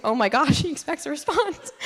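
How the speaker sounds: high, flustered inner panic, pitched well up; retelling her own alarm in a squeaky rush; panicked realization. Retold or imitated. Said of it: "and I was like".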